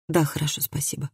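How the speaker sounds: very soft, shy murmur; hushed, meek thanks; whispered reply. A narrator's reading. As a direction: answer barely above a whisper, fading out. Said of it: "прошептала Хильди".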